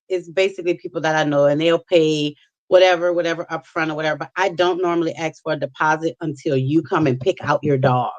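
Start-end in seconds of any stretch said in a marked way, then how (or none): none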